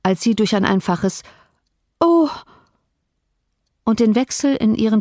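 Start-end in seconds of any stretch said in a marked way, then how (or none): 2.0-2.3 high, loud "oh" of dismay; cry of despair. A narrator's reading. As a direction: let out a sharp, despairing cry. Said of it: "Verzweiflung ausdrücken".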